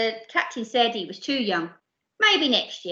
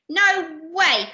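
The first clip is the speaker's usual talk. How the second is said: panted; 6 semitones higher, 4 dB louder than usual. Acted. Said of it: "panted Khan".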